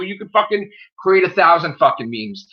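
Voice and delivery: ordinary delivery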